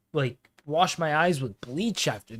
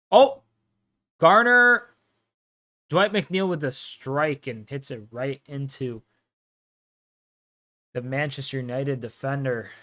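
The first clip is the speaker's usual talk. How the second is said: excited commentator call; play-by-play; 2 semitones higher, 7 dB louder than usual. Acted. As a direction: call the action as it happens.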